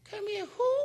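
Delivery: high squeaky puzzled voice; a timid bird not getting it; confused little bird. Retold or imitated. The bird puzzled at being called eagle.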